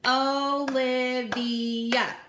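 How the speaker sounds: "Olivia" said slowly, O-li-vi-a, each syllable apart; clapping out syllables. Shown as a demonstration. Teacher to kids at home, clapping out syllables slowly. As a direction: stretch "Olivia" into four slow separate syllables. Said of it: "clap out the syllables in my name".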